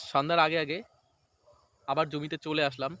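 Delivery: ordinary talk, nothing marked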